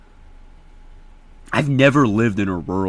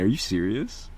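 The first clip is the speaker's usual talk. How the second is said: normal talking voice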